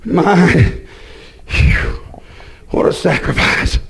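a hint of a sighing, put-upon churchwoman; lightly mocked mournful sighing; mock moping woman. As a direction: sigh it out like a martyr in long dresses. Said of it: "You go moping around like".

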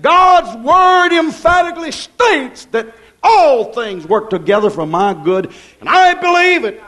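high, loud and slow, stretching each promise; full-force preaching. Acted. Preacher declaring God's promises to the church, slow ringing proclamation. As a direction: proclaim it slowly to the rafters.

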